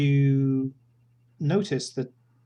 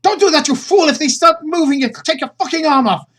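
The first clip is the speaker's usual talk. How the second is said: much higher, much louder, a frantic screaming warning; furious warning; 14 semitones higher, 12 dB louder than usual. Acted. She screams at Sergei to keep his arm out, shrieking high and loud. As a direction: yell to save his arm. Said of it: "And she goes".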